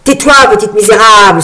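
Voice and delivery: loud, furious command; angry outcry. A narrator's reading. Frog fairy silencing laughing Marguerite, angry. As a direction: lash out and silence her. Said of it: "entra dans une inexplicable colère".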